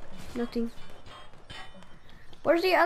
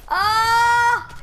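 startled yell; 6 semitones higher, 6 dB louder than usual. Real, not acted. Ambushed in shootout.

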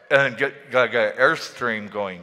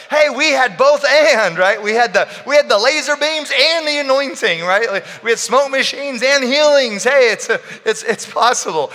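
high, loud and thrilled; almost shouting with excitement; excited; 11 semitones higher, 8 dB louder than usual. Retold or imitated. Retelling an excited reply.

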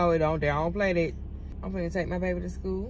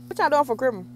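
normal talking voice

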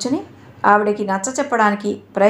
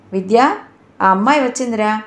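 usual speaking voice

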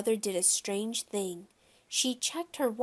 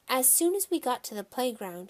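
plain reading voice

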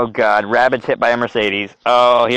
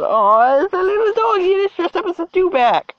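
very high, squealed cooing; sky-high cutesy squeal. Real, not acted. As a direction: squeal it like you're fussing over a puppy. Cooing over dressed-up dog, second try.

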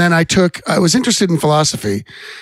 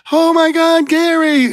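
much higher, gushing fangirl squeal; swooning girls; 13 semitones higher, slower than usual. Retold or imitated. Schoolgirls swooning over the band's bass player, high gushing. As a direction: gush over the cool kid like a smitten schoolgirl. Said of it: "the girls just like".

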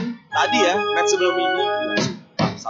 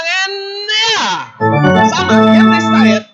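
excited shout; 7 semitones higher, 7 dB louder than usual. Real, not acted. Tying shot, commentator erupts.

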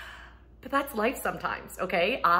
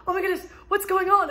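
much higher, louder alarmed cry; startled mom; 12 semitones higher, 7 dB louder than usual. Retold or imitated. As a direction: jump out of sleep in a panic. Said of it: "I get up, I'm like".

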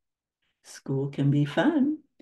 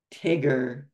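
sternly; 3 semitones lower than usual. Acted. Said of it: "said Christopher Robin sternly".

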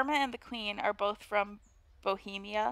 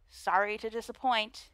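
ordinary talk

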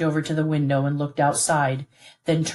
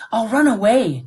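louder, higher defiant threat; defiant outburst; 6 semitones higher, 6 dB louder than usual. A narrator's reading. Son threatens his father to run away, loud. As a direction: throw it at him in defiance.

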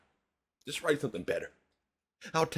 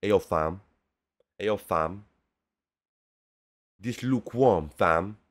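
mock London slang; 4 semitones lower, 6 dB louder than usual. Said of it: "Hey, hey, Crow D".

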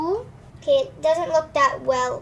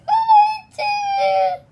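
put-on guilty child; 7 dB louder, much slower than usual.

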